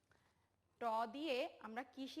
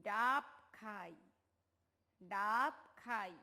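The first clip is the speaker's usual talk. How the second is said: slow, spaced ডাব খাই, said twice; teacher leading a choral read; 3 semitones lower, 4 dB louder, much slower than usual. Shown as a demonstration. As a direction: lead the class word by word through ডাব খাই. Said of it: "আমরা একসাথে বলি সবাই".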